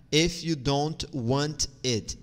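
"If you don't want it" slowly, word by word; slow careful model. Shown as a demonstration. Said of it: "tu vai dizer if you don't want it". ("If you don't want it" devagar, palavra por palavra)

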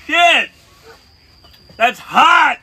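loud burning yelp; pained shout. Real, not acted. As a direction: cry out from the heat. Reacting to the burning spice.

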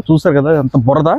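usual speaking voice

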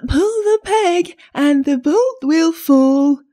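high, slow fake-grandmother call, loud; wolf posing as granny, drawn out and high; wolf softening his voice. A narrator's reading. Wolf luring the child inside, falsely sweetened high voice. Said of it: "softening his voice as much as he could".

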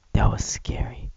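breathless whispered aside. Real, not acted. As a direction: hush it out after the scare. Whispered take.

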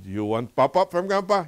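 high, loud grandpa warning; warning threat. Retold or imitated. Grandpa warns toddler of a smack, threatening. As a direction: raise pitch and volume in a playful retold threat.

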